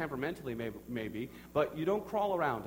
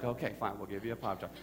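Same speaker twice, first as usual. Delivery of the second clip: low, softer mock resignation; resigned giving in; 7 semitones lower, 5 dB quieter, faster than usual. Dad giving in to his toddler, retold resignation. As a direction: give in like a worn-down parent.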